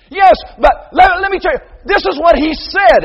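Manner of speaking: high, loud shouted excuse; protesting shout. Retold or imitated. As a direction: shout back a defence.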